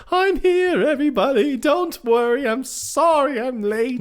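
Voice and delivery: very high, singing-out grand entrance; falsetto mock mother. Man in women's clothes arriving as mom, falsetto gushing. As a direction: sweep in with a hammed-up falsetto woman's voice. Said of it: "and he was like".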